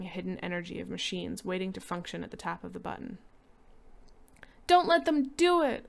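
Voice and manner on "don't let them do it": high, loud desperate wail; boy wailing up at the ceiling. Acted. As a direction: cry out to the house for help. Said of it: "wailed Peter at the ceiling".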